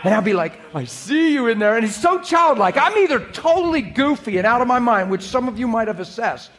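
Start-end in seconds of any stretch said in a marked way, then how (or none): 0.7-1.8 higher-pitched teasing greeting; playful singsong. Acted. Preacher replaying his greeting to God in the truck, playful singsong. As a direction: lightly replay a peekaboo call.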